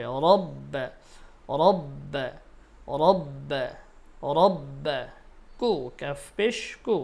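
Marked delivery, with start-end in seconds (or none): 0.0-5.0 রব্ব with a full, thick র; heavy ra. Shown as a demonstration. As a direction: round the র in রব্ব. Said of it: "রর উচ্চারণ মোটা হবে, পুর হবে". (মোটা র দিয়ে রব্ব)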